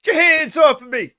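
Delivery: high, loud put-on voice for the grumpy cat; cranky cat voice, high and loud; cat's voice. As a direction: voice the cat snapping at its owner. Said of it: "she's like".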